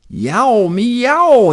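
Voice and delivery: high, loud, drawn-out meow; cat calling down. Acted. Henry calls down to the kid from the balloon, high loud meow. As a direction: yowl down proudly like a cat. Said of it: "he called down to the kid".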